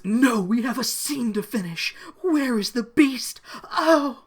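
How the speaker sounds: high, loud hammed-up demand; overacted plea ending in a yelp; melodramatic distress. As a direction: cry out like a frantic stage heroine. Acts out frantic Jem line.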